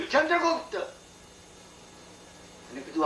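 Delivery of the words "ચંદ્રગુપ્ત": mild call out. Retold or imitated. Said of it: "Chanakya બૂમ પાડી".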